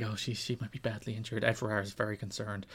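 whisper-soft grave concern, like golf commentary; hushed tones. Retold or imitated. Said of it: "They go full Owen voice on this".

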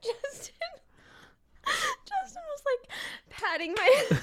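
very high, faint squeak through laughter; helpless laughter. Real, not acted. Recounting being patted after choking, weak with laughter. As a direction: barely get the words out for laughing.

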